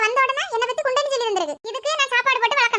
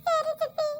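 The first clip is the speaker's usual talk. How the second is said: higher, soft, tearful reply; tearful yes; 5 semitones higher, 5 dB quieter, slower than usual. Acted. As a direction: answer through tears. Child answers after being scolded. (அழுகையுடன் பதில்)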